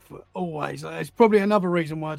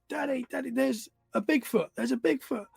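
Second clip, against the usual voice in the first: higher-pitched, softly panicked daughter; imitating freaked-out child; 7 semitones higher, 4 dB quieter than usual. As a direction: mimic a frightened little girl, without shouting. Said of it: "just started freaking out".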